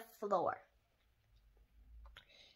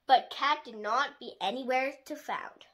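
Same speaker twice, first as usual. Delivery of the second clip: plain reading voice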